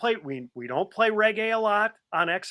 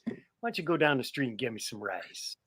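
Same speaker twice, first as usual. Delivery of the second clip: lightly voiced Jiggets; 4 semitones lower, 9 dB quieter than usual. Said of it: "or Jiggets".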